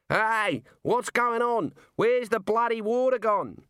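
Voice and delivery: high, loud startled yell; shouting. Acted. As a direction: yell it across the street. Man at the pump calls out.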